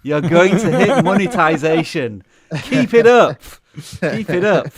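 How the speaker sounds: loud, high announcer-style movie phone delivery. Pretend. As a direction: boom it out like an automated movie phone line. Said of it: "That was a very movie phone voice".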